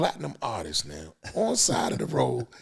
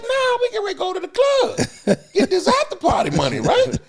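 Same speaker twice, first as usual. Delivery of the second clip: laughing high voice; 6 dB louder than usual. Retold or imitated. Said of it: "It's like".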